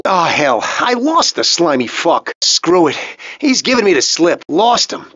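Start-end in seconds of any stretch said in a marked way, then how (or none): none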